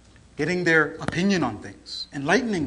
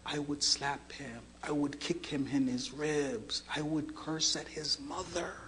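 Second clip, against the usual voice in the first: hushed, heavy retelling of a confession; tearful confession; 2 semitones lower, 8 dB quieter than usual. Retold or imitated. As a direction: confess softly, with a touch of tears. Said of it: "He starts crying".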